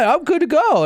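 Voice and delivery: high-pitched mock insistence; indignant drunk. Storyteller mimics a drunk insisting on driving, indignant. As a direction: imitate a drunk protesting he's fine.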